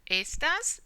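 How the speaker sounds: "Estas" stressed on the opening E, rising at the end; stress on first syllable. Shown as a demonstration. Teacher showing students the word meaning these, natural first-syllable stress. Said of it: "the natural stress is here".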